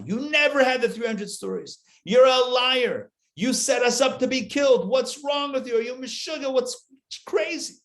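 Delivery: high, loud accusing yell at the fox; accusing shout. Retold or imitated. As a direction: hurl the accusation like a panicked crowd. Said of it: "And now accusations fly".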